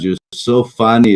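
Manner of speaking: ordinary talk, nothing marked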